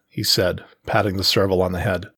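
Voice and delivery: ordinary delivery, nothing marked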